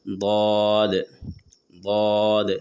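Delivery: ضاد said thick and heavy, not زواد or دواد; emphatic ض in ضاد; heavy daad. Shown as a demonstration. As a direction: give ضاد a full, heavy sound. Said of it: "موٹی آواز نکالیں گے".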